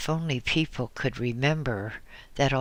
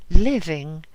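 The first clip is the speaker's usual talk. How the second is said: much louder, hammered home; loud repeated answer; 4 semitones higher, 10 dB louder than usual. Acted. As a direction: repeat it hard, almost crying out. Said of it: "she answered abruptly".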